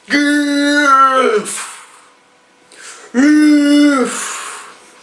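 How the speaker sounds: loud high drawn-out yell; yelling fall. Retold or imitated. Falling thirty meters, a long loud high yell. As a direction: hold a long falling cry. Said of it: "you're hearing me yelling really loud".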